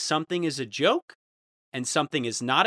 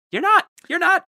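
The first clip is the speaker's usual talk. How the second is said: much higher and louder taunting yell; mocking shout; 8 dB louder than usual. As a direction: hammer it home like a playground taunt. Mock retort to commenters.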